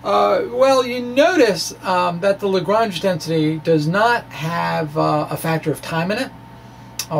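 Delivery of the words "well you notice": ordinary talk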